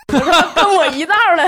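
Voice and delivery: loud, high words breaking into laughter; laughing. Real, not acted. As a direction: blurt it out through laughter. 讲骂鬼时自己笑场. (笑着大声说)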